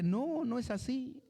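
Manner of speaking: un no firme, agudo y lento; negativa firme. Retold or imitated. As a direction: contesta como la palabra que corrige. La palabra responde tajante. (la palabra negando con firmeza, aguda y lenta)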